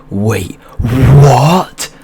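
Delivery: loud, high drawn-out WHAT swooping upward; hammed-up stunned double take, blown right up; exaggerated disbelief. Storyteller shows his looping reply to his friend, exaggerated shock. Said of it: "Like that for about thirty minutes".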